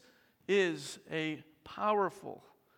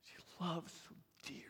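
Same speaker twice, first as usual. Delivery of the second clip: whisper; 17 dB quieter, faster than usual. Acted. Tender aside about Mary's loss.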